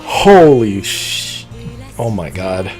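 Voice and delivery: usual speaking voice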